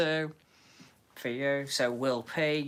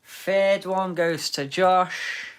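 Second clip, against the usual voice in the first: game show announcement; 4 semitones higher, 6 dB louder, slower than usual. Acted. Announcing winner slowly.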